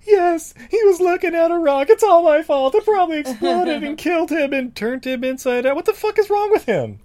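high, loud frantic wailing; hysterical woman. Retold or imitated. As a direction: overplay a frantic panic. Mocking hysterical Alex.

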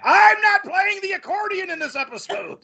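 very high, hammed-up angry protest; mock outraged actor. Actor storming into writers' room with script, mock outrage. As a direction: storm in with a play-acted tantrum. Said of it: "Anthony Clark just storms in".

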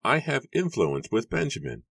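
high-pitched, measured claim; Matthew's character voice. A narrator's reading.

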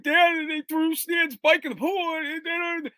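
put-on high, slow, pleading little boy voice; panicked kid. Boy telling dad the bike got thrown, high pleading kid. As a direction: spell it out to dad like a frightened child. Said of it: "I'm like".

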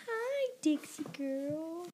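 high, slow sing-song greeting; pet-directed cooing. Real, not acted. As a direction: coo a drawn-out hello to the dog. Greets a pet mid-sentence.

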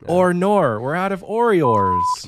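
loud, high, drawn-out mock Aussie accent; bad Australian accent. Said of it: "my Australian accent's really bad".

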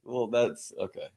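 normal talking voice

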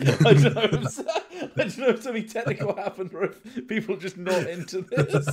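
amused, words bubbling with laughter; laughing. Real, not acted. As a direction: laugh helplessly while trying to explain. Laughing about viewers leaving.

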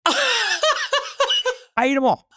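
laughing it out slowly. Real, not acted. Telling the host about the calamari, laughing. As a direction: say it through laughter.

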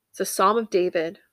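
normal talking voice

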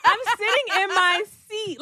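high, loud, laughing through the words. Real, not acted. Telling the story of her seat, laughing hard. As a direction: burst out laughing while talking.

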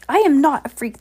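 a loud, high snarl of protest; snarling, impatient. A narrator's reading. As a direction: snap back, insulted and impatient. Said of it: "said snarledly and impatiently".

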